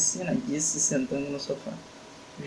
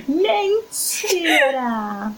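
shocked shout; 11 semitones higher, much slower than usual. Acted. Shocked at seeing tickets.

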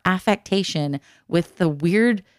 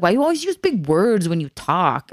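sing-song mimicry of their accent; imitating classmates' accent; 2 semitones lower than usual. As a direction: taunt in their accent, swooping tune. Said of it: "they sounded like that".

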